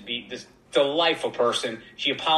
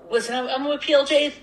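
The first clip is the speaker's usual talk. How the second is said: very high squeaky self-mockery; little squeaky voice; 11 semitones higher, faster than usual. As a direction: plead in a tiny, squeaky voice. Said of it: "me with my little squeaky voice".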